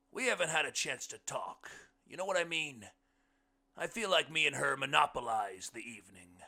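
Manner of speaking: big, high voice sinking at phrase ends; loud, folksy character voice; hearty blind man. A narrator's reading. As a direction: project it high and loud, like a booming guest. Blind man's speech in story.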